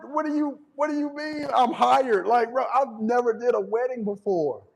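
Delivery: high, slowed, incredulous questioning; can't believe he just got hired; stunned disbelief. Retold or imitated. Told he's hired for a wedding, high and incredulous.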